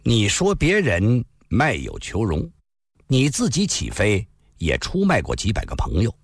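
calm, deliberate accusation; a young swordsman slowly exposes the hero's betrayal; slow and measured. Acted. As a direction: spell out the charge slowly and coolly. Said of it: "缓缓地说道".

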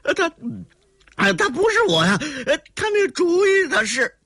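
high, rushed, stuttering excuse; panicked denial. Acted. Cornered villain shifts the blame, high panicked stammer.

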